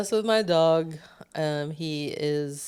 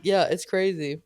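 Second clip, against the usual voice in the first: ordinary delivery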